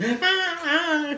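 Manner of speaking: very high falsetto shriek; mock screaming man. Tough guy screams in the storm, high mock shriek. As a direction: imitate a panicking man's shrill scream. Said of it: "And he's screaming".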